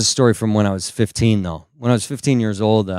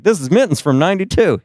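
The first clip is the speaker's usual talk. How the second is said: eager mock presenter; 8 semitones higher, 3 dB louder, faster than usual. Hoarder proudly presents a flat cat.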